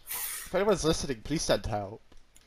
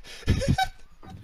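high laugh. Real, not acted. Laughs during the food order.

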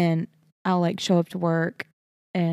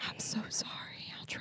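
whispered, apologetic promise; meek apology; 17 dB quieter than usual. Retold or imitated. Actor apologizing to director, meek whisper. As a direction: shrink into a very soft whisper. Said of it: "You're like".

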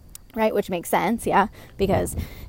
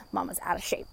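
whispered aside; 3 semitones lower, 9 dB quieter than usual. Real, not acted. Self-mocking aside after a sigh.

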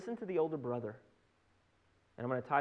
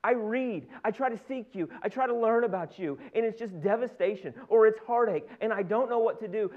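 higher, emphatic acted lament; aggrieved pleading; 3 semitones higher, 4 dB louder than usual. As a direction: plead and demand an answer from God. Acted anguished complaint to God.

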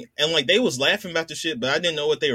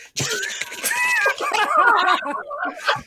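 high squealing laugh. Real, not acted. Big laugh at rain stick.

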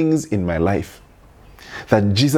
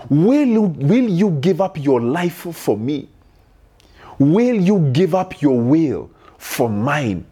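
pleading climax; 5 semitones higher, slower than usual. Real, not acted. Preacher's climax of repeated questions.

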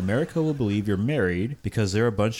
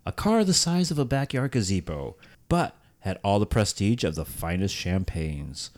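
ordinary delivery

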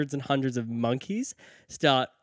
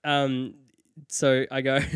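ordinary talk

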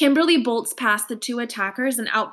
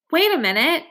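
startled, higher protest; 6 semitones higher, 3 dB louder than usual. A narrator's reading. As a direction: object in surprise. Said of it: "I protest".

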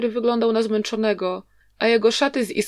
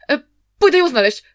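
fast, hurried reply to his brother; said quickly; 6 semitones higher, 7 dB louder, faster than usual. A narrator's reading. Young brother hastily answers angry elder brother, fast and flustered. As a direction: rush the words out nervously. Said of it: "powiedział szybko".

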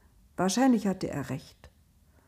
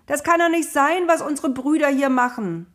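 higher, louder pleading scream; desperate screaming; 8 semitones higher, 8 dB louder than usual. A narrator's reading. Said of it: "schrie Josef".

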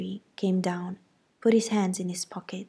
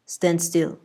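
stern uncle; 5 dB louder than usual. A narrator's reading. Uncle restraining girl.

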